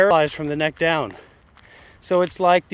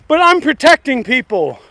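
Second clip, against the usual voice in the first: higher, louder indignant mimicry; mock self-righteous viewer; 6 semitones higher, 7 dB louder than usual. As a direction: parrot a self-righteous defender, a bit up. Mocking self-appointed protector.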